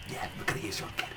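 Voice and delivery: whispered aside, as if hiding the plan; sneaky whisper. Retold or imitated. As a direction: breathe it without voice, scheming. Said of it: "where it's like".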